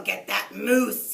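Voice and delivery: loud slow growl. A narrator's reading. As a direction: growl it out in fury. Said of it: "he growled".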